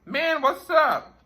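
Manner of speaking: high, loud, slow call; a hawker hailing the visitor from inside. Retold or imitated. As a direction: draw it out to grab attention. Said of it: "he obviously was speaking".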